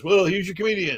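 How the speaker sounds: high, dismissive announcer tag; mocking DJ dumping the comic on stage; sardonic DJ handoff. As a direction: toss off the intro like a DJ. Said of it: "he goes".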